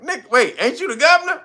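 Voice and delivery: high, loud mock disbelief; high mocking "ain't you the governor"; incredulous questioner. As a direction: squeak it in disbelief.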